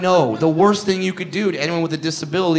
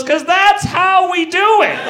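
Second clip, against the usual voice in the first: much higher, slow, laughing through the words; wheelchair guy laughing; slower than usual. Retold or imitated. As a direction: squeeze it out mid-laugh. Wheelchair man answers, laughing.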